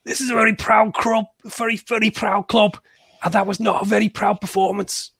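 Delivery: high, put-on manager voice quoting the interview; mimicking the manager. Fan podcaster mocking the manager's quote, high put-on voice. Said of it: "the next quote is".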